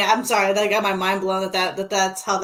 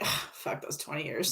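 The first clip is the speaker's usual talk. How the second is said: dismissive mutter; 5 semitones lower, 12 dB quieter than usual. Retold or imitated. Rich people voiced dismissively.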